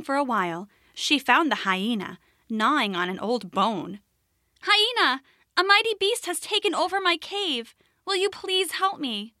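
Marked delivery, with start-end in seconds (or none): 4.6-9.3 high, pleading small rabbit; high little rabbit voice. Acted. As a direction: beg for help in a high rabbit voice.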